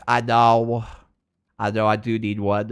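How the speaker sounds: ordinary talk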